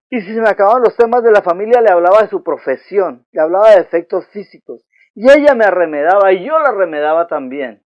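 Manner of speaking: loud, driving, speeding up through the list; preaching at full force. Acted. Preacher recounting past quarrels.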